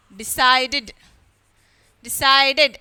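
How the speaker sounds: decided in three syllables, "de-cide-id". Shown as a demonstration. Teacher models the third rule, decided with an ID ending. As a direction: sound the ending of Decided as a full "id", each word set apart. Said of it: "E D is pronounced as".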